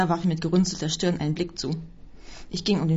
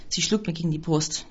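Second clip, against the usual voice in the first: plain reading voice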